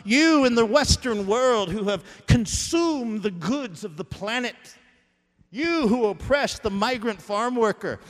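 pulpit-pounding sermon voice; preaching at full force. Acted. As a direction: thunder it down like a prophet.

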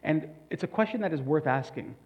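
ordinary talk

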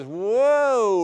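loud, high swooping whoa. Retold or imitated. Explaining manic highs, a loud rising whoa. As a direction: soar up like a mood spike.

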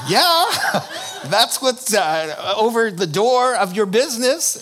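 loud, high laughing jab. Real, not acted. Pastor laughing at the psychics' sign, loud and amused. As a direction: laugh while mocking them.

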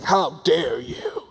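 slow, indignant, pearl-clutching gasp; offended Cornwallis. Retold or imitated. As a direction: play a scandalized British general. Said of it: "clutching his pearls".

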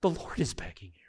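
hushed whispered plea; urgent whispered appeal to the congregation. Real, not acted. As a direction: drop to a whisper for the Lord's plea. Preacher drops to hushed line.

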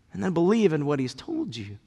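ordinary delivery

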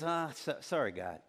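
acted half-hearted sorry, dropping away; offhand apology. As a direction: mumble a throwaway apology. Offhand apology, not real repentance.

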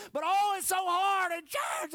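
high, loud, slow cry; anguished pulpit shout; shouted lament. Acted. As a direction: cry it out to the rafters.

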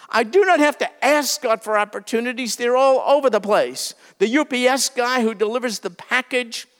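normal talking voice

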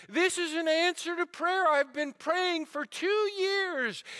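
high, loud burst of joy; overjoyed. Retold or imitated. A big man greets the visitor, bursting with joy. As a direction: shout it out like a prayer just answered.

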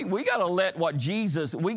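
normal talking voice

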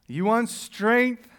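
slow, drawn-out question; slow rhetorical challenge. Acted. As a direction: draw it out to challenge them.

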